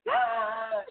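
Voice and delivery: high mock scream; imitated scream. Imitating the actress on the real take, a high mock scream. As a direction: let out a high scream, held in volume. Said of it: "screaming at the top of her lungs".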